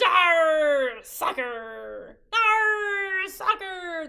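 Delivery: loud pirate yelling; crew yell. A narrator's reading. Captain and crew yell while playing soccer. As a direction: bellow it while kicking the ball. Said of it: "the crew yelled".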